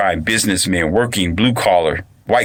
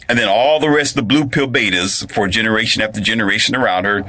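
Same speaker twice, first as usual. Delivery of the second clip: usual speaking voice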